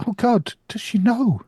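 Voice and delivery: panicked. Acted. Simon panicking.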